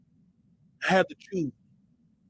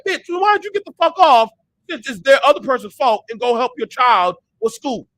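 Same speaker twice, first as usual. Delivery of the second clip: loud retort; 4 semitones higher, 7 dB louder than usual. Retold or imitated. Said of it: "I'm like".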